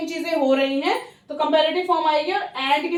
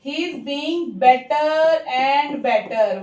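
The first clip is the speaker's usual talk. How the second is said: slow example sentence; much slower than usual. Shown as a demonstration. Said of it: "जैसे कि".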